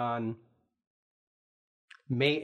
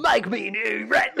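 loud, slow, higher hammy Aussie; Australian accent; 7 semitones higher, 6 dB louder, slower than usual. Pretend. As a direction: shout it in a bad Australian accent. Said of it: "with an accent that's Australian".

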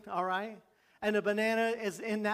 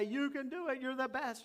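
mock crowd cheer; 5 semitones higher, 6 dB quieter than usual. Preacher voices the crowd cheering on the daredevil, mock cheer. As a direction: lightly mimic an adoring crowd. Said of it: "they'd all roar".

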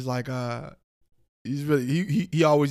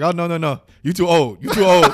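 retold coworker; 6 semitones higher, 13 dB louder than usual. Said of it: "He's like".

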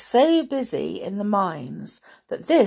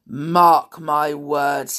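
loud, very slow mock growl; 3 semitones lower, 6 dB louder, much slower than usual. A narrator's reading. Grumpy warns the guard at the door, growling. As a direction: growl it out as a warning. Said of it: "he growled".

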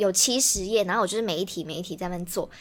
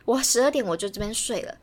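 ordinary delivery

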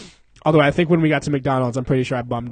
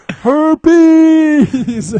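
mocking call; 12 semitones higher, 9 dB louder than usual. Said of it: "Tom's in the back like".

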